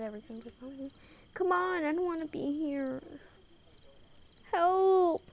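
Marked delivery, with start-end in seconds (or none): 4.5-5.2 loud, high call for help; panicked cry for help. Real, not acted. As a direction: yell it out in mock panic. Fleeing monster in game.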